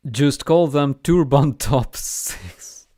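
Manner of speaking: slow, hammed-up Middle Eastern accent, laughing mid-line; a mock Middle Eastern accent, drawn out and breaking into a laugh; Middle Eastern parody accent. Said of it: "work on your Middle Eastern stereotype parody accent".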